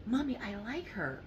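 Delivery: put-on high, soft little girl. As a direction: voice the small daughter sweetly. Maggie voiced by mom.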